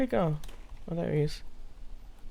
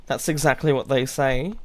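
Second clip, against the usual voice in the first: normal talking voice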